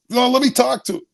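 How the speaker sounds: high, pleading imitated mom; mother in background. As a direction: pitch it way up as mom butting in. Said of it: "I could hear my mother in the background".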